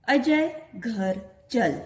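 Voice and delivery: each word spaced out slowly for the class; slow reading aloud. Shown as a demonstration. Teacher reading a sentence slowly to her pupils. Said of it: "वाक्यों का वाचन मैं करने वाली हूँ".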